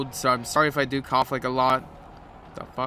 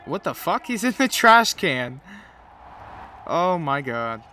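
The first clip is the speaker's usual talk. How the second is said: surprised exclamation; 3 semitones higher, 4 dB louder than usual. Real, not acted. Surprised at game scene.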